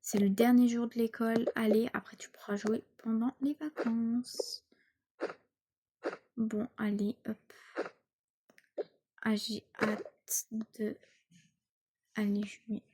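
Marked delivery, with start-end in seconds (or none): 1.9-7.4 soft hushed whisper. Acted. Mother whispers goodnight to her daughter, hushed. 9.2-12.8 slow hushed whisper. Acted. Girl whispers in bed before school, slow whisper.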